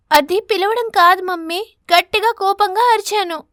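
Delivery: loud, high, indignant reply; a daughter angrily correcting her mother; angry retort. Acted. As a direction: snap back at mother.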